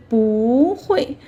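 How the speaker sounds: 不 glides upward, 会 falls; rising then falling. Shown as a demonstration. Teacher repeats the easier 不会 for learners, 不 rising. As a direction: lift 不 slowly, then drop 会. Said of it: "rising tone".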